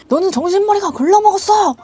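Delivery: high, loud mock lecture; scolding boss. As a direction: imitate a boss berating an employee. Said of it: "나한테 뭐라 하는 거예요".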